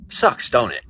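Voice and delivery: normal talking voice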